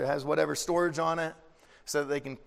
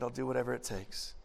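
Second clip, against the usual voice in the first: a soft, sincere promise, lower and quieter; tender Jesus; 4 semitones lower, 8 dB quieter than usual. Retold or imitated. Jesus quietly promising to do whatever it takes, softly. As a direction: say it quietly, meaning every word. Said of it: "He said".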